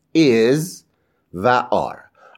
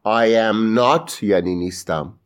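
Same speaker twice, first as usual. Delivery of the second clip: normal talking voice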